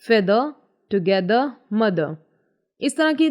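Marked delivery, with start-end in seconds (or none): 0.0-0.4 feather with no pressure on the final r; silent R. Shown as a demonstration. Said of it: "ये थोड़ा silent रहेंगे". 0.9-1.4 together with no pressure on the final r; silent R. Shown as a demonstration. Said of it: "ये थोड़ा silent रहेंगे". 1.7-2.1 mother with no pressure on the final r; silent R. Shown as a demonstration. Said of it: "ये थोड़ा silent रहेंगे".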